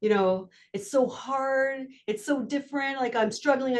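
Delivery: drawn-out, mildly exasperated mock complaint; flustered kid. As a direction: voice a struggling ninth grader lightly. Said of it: "he was just flustered".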